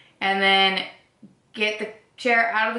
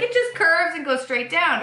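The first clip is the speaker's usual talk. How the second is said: higher, breezy selling it; mock reassurance; 8 semitones higher than usual. Describing the hill to her friend, higher and breezy. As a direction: make the hill sound easy.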